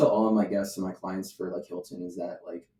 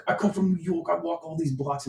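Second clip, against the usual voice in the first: high, hammed-up New Yorker bragging; mock New York accent; 7 semitones higher than usual. Host mocking New York guests who brag about walking, in a New York accent. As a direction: puff it up like a tourist from New York. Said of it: "they sound like this in my head".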